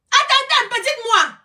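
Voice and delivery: high, loud wait-a-minute; indignant outburst. Real, not acted. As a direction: stop them short, outraged. Indignant rant about actress fees. (attendez, voix haute et indignée)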